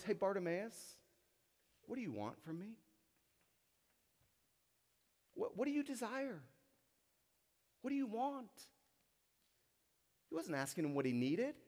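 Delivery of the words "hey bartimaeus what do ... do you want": gentle, softer, caring question; tender invitation. Retold or imitated. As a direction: ask him kindly what he truly desires. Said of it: "I think his heart was".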